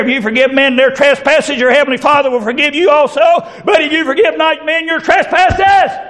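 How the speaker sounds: high preached verse; preached scripture. Acted. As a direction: lift the verse up. Quoting Lord's Prayer, preaching.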